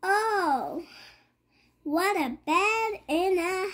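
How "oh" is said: ordinary talk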